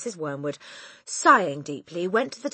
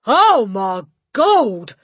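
long, slow, loud cry; horrified yell; 5 semitones higher, 13 dB louder, much slower than usual. A narrator's reading. Father seeing himself in mirror, horrified yell. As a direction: drag out the words in horror. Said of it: "yelled the father".

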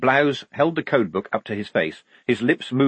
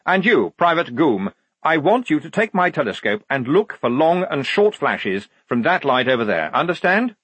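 higher, commanding officer; officious lieutenant; 6 semitones higher, 4 dB louder than usual. Acted. Lieutenant assigning the telescope to a private, officious. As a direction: issue the order, rising on the final check.

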